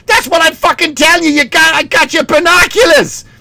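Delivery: high-pitched hammed-up dad rant; mock outrage, straining up high; exasperated Boston dad. Dad arguing with son over present, hammed-up exasperation. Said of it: "then the dad's like".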